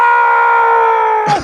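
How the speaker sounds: high shrieking death cry; death scream. Retold or imitated. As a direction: let out a horrific death scream. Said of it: "you just go".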